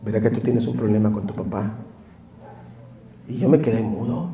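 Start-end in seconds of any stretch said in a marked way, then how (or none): 0.0-1.7 softly repeated question. Retold or imitated. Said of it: "donde me duele".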